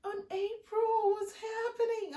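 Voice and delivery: put-on high, slow, tearful child asking; high pleading child voice; crying little girl. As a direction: imitate a confused crying girl. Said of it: "she's crying like".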